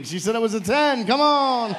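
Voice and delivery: loud, high game show cheer; high, loud, whooping announcement; rousing shout. Real, not acted. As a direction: shout the winning answer to rouse the crowd. Host cheers a matching answer.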